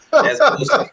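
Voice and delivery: high-pitched scoff; scoffing dismissal. Real, not acted. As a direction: jump way up into an incredulous squeak. Scoffing at a traveler claim.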